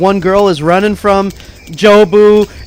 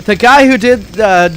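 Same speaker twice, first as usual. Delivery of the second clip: ordinary talk, nothing marked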